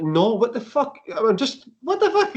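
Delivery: high-pitched, exasperated retort; mock indignation. Non-drinker answers pub-goers asking if he's alcoholic, mock indignation.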